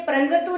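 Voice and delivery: परंतु mispronounced as "प्रंगतु", with an "ng"; wrong nasal sound. Shown as a demonstration. Teacher repeating what we don't say, परंतु with an "ng" sound. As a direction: put a back-of-mouth "ng" into परंतु: "prang-tu". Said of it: "प्रंगतु नहीं बोलते".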